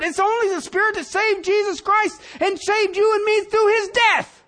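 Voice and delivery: high, loud preacher's shout, building; fiery sermon climax, shouted; preaching at full force. Acted. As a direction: thunder it from the pulpit.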